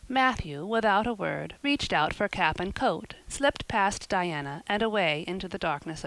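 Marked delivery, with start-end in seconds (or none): none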